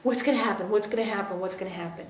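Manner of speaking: anxious mind looping, dying to a murmur; fading fearful loop. Retold or imitated. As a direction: let the fear spiral fade away. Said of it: "that fear that".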